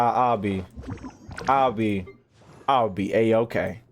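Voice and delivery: ordinary talk, nothing marked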